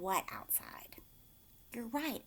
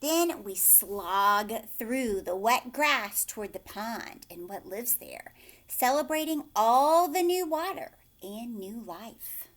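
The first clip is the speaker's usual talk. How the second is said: put-on gentle storybook reading to children; bedtime-story narrator; story narration; 6 dB louder than usual. A narrator's reading. Reading picture book aloud.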